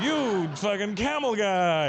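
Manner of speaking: high, drawn-out playful jeer; single out a guest with stretched, teasing words; hammed-up slow heckle; mock roasting. Comic roasts a guest in story.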